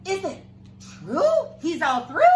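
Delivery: high, loud wondering; lady's question. Acted. Lady dressed in blue asking the townspeople, high and loud. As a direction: ask hopefully like a villager.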